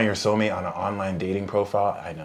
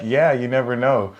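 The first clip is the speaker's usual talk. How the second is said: ordinary talk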